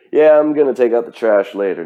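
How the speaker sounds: loud, casual, noncommittal brush-off; lazy put-off promise. Retold or imitated. Imitating someone who keeps delaying, said loud and casual. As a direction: toss it off like a chore you'll skip.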